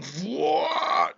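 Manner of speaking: high, drawn-out "whoa" yell; imitated battle scream. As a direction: imitate a fighter screaming through an attack. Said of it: "screaming through it, going".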